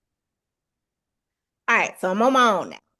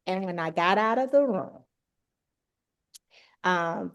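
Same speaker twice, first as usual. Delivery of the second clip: usual speaking voice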